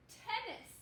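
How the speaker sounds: bright model word. Shown as a demonstration. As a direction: say the finished word proudly. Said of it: "Like the sport with a racket".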